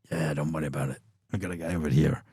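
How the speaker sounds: lightly imitated New York tough guy; bad New York accent. Ex-Marine promoter reassuring the band, bad New York accent. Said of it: "So that's a real bad accent".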